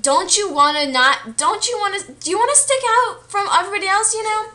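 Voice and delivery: retold angry rant, high and rushed; furious. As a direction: imitate the angry brother, pitch climbing fast. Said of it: "Bob was furious".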